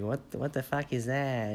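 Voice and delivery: put-on squeaky kid voice. Talking to future self, a mock squeaky child voice. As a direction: imitate your little kid self, squeaky and stunned. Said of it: "I used to be a fucking squeaker".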